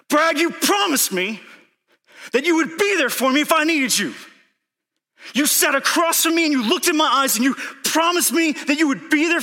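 desperate shouted plea, far above normal pitch; scared, desperate shouting. Retold or imitated. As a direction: scream a demand at a friend who promised. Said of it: "I'm just scared".

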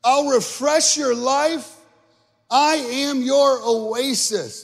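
high, loud, slow proclamation; stretched, soaring preacher's call; preaching Jesus' promise. Acted. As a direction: proclaim each word high and drawn out. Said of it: "he says".

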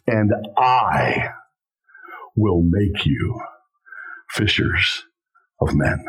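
low, slow, catching in the throat; voice breaking. Real, not acted. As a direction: let emotion choke the promise. Quoting Jesus, voice breaking.

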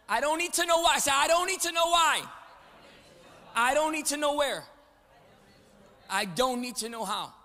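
loud, high, building chant of faith; full-force preaching. Acted. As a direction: preach it loud so the room answers.

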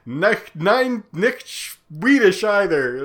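loud, high, drawn-out mock broken German; hammed-up German accent, stretched and insistent. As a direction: imitate someone struggling through broken German. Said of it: "broken German to this Swedish man".

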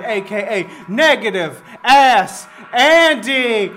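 ordinary talk, nothing marked

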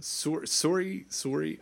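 testing a Canadian "sore-ee"; tentative Canadian pronunciation; Canadian accent. Shown as a demonstration. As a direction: try sorry slowly with a rounded Canadian o. Trying out the Canadian sorry.

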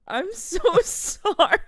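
high, loud, drawn-out sorry through laughter; retold condolence breaking into giggles; laughing apology. Replying to a friend whose dog died, laughing apology. Retells reply while cracking up.

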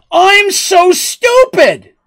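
very high, slow, loud drawn-out wail; dramatic wail. Acted. Petal bursting in on her friends, high slow wail. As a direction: wail it like a grand confession. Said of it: "bursts into the room and says".

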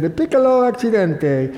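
high, lightly Italian police voice; Italian accent. Retold or imitated. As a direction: mimic an Italian officer, lightly. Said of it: "As the Italian police said".

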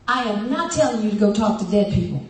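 ordinary talk

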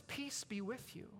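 soft, slow and gentle; gracious greeting. Retold or imitated. Jesus greeting doubting Thomas, gently. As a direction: say it kindly. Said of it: "He's gracious to Thomas".

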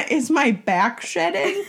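laughing question, high and slow. Real, not acted. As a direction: giggle through the question. Laughing at own joke.

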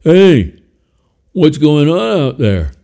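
high, loud voice from inside a suitcase; muffled voice. Acted. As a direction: call out muffled from a box, slowly. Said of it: "a muffled voice said".

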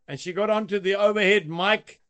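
usual speaking voice

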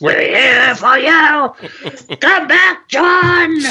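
very high, slow wailing cry; wailing call. Pretend. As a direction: wail after him like a ghost. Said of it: "calling after you".

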